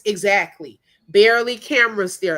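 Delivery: ordinary delivery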